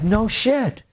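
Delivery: high, astonished "no shit"; reacting to the auditor's story with awe; amazed disbelief. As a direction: blurt it out in disbelief.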